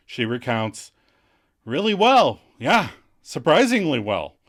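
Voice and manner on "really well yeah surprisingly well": loud, swooping mock dejection; forlorn. Monica recounting how Rachel took it, hammed-up forlorn. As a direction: stretch it out, put-on and disgruntled. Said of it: "Monica drops forlorn into the Barker Lounger".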